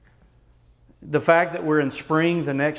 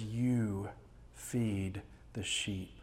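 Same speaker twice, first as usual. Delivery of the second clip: "You" pressed, then "feed the sheep" slow and soft; slow emphatic charge; 4 semitones lower, 11 dB quieter, much slower than usual. Acted. Jesus charging his disciples to shepherd the flock, slow and firm. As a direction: lay each word down slowly as a charge. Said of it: "so he says".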